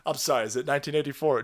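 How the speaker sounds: normal talking voice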